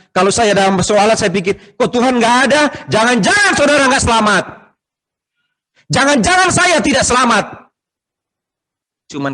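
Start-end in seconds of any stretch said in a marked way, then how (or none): 0.1-7.5 high, loud preaching at full force; impassioned shouted warning. Real, not acted. Preacher warns congregation they may not be saved, impassioned shout. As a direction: cry out the warning, pitch soaring.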